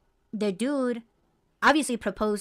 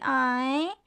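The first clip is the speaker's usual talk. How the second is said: cooing aw; 4 semitones higher than usual. Retold or imitated. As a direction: melt over the dog. Cooing at her dog.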